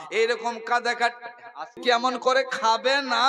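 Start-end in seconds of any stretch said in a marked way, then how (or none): none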